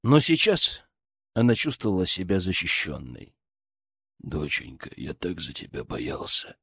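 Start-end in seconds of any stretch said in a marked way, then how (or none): 4.2-6.6 low tender father. Acted.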